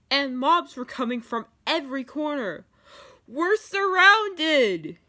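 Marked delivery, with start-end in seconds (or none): 3.3-5.0 high, loud drawn-out yell; panicked yell. A narrator's reading. Steve warns the others they're surrounded, yelling. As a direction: cry out that danger is all around. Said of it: "yelled Steve".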